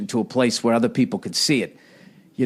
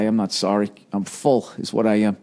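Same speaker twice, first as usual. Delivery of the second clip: normal talking voice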